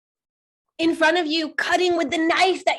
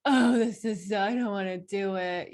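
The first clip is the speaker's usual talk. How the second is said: reluctant groan; 6 semitones lower, 5 dB quieter than usual. Retold or imitated. Reluctant inner voice quoted.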